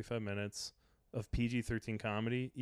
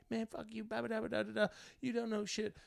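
mock drunk heckler; 10 semitones higher, 5 dB quieter than usual. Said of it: "heckler's retold rant".

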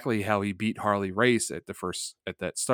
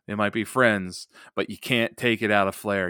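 usual speaking voice

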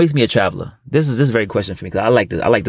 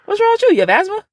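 falsetto teasing shout; high mock outrage. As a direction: squeak it up in mock shock. Mocking co-host's sigh.

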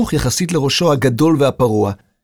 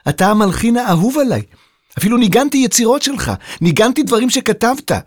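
high, raised-voice admiration; eager shouted praise; shouting; 11 semitones higher than usual. A narrator's reading. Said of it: "חזרה בצעקה".

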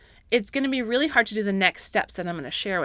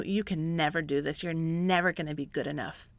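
softer, quietly condemning whisper-like voice; accusing inner voice; 4 semitones lower, 7 dB quieter than usual. Retold or imitated. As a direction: voice the inner critic, low and belittling. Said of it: "Whatever voice that's telling you".

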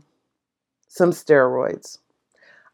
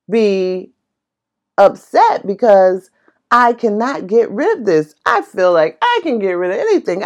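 normal talking voice

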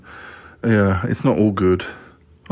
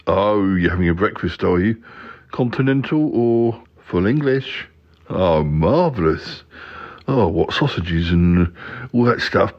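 slow, savouring marvellous; Yeti Uncle John relishing; slower than usual. Pretend. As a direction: roll out the words with relish.

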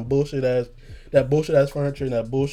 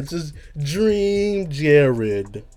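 extremely slow, stretched name drop; exaggerated drawn-out announcement; much slower than usual. Storyteller repeating his disclaimer, exaggerated drawn-out announcement. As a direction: hold every word as long as possible.

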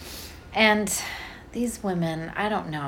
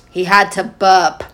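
loud, matter-of-fact nurse voice; brisk nurse; 9 dB louder than usual. Retold or imitated. Mother imitates nurse dismissing her fear. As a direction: state it flatly like a busy nurse. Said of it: "she slaps his back, and she goes".

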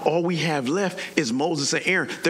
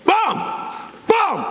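explosive staff-strike booms; imitated blows; 12 semitones higher than usual. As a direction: shout each strike as a sharp burst. Imitating Moses striking the rock.

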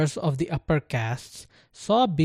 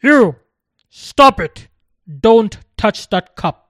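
sharp loud shout, pitched high; 6 semitones higher, 12 dB louder than usual. A narrator's reading. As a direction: yell it to stop a child grabbing the cup. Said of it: "a worker shouted".

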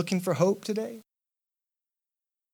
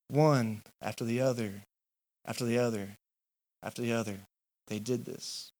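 softer and lower, fading away; fading repetition; 4 semitones lower, 8 dB quieter than usual. Acted. Preacher picturing the seven rounds, fading to quiet.

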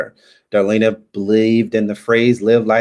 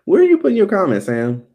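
exasperated call; 10 semitones higher, 3 dB louder, faster than usual. Real, not acted. Calling out to a viewer.

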